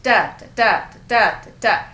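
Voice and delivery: loud, punched DA, each followed by a light beat; stressed-unstressed beat. Shown as a demonstration. Teacher showing the trochaic pattern to students, loud rhythmic "da, da, da". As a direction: beat out "da, da" like a drum, strong then weak. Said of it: "which was the stressed, unstressed".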